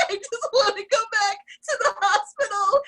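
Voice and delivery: high, wailing mock sob; retold hysterical crying. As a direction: play back her own breakdown, half laughing. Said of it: "hysterical crying".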